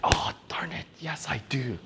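whispered, defeated concession; grudging whispered yes. Retold or imitated. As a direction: concede it under your breath. Brother gives in.

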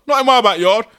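not in my backyard, loud and high, rising at the end; indignant shout. Shown as a demonstration. Podcaster acting an angry neighbour, loud high shout. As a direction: bellow it like an outraged neighbour. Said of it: "N I M B Y".

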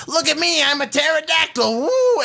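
high, excitable mock motormouth; noisy show-off. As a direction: whoop like a show-off in flight. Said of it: "And he just keeps, like, making no".

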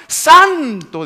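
high, very loud gasp of a word; shocked exclamation. Retold or imitated. Tenchis hearing of the late vigil, shocked. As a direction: recoil in mock shock.

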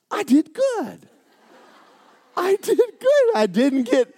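high, thrilled self-praise; giddy, high proud voice; gleeful pride. Retold or imitated. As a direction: squeal with delight.

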